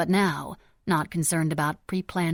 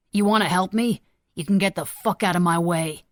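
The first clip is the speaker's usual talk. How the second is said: louder, raw furious scream; 7 dB louder than usual. A narrator's reading. Gunman to the senator blocking him, furious scream. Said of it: "Tanner screamed".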